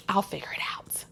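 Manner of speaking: breathy whisper of giving up on help; unvoiced mutter, shutting others out; whispered resignation. Retold self-reliant resignation.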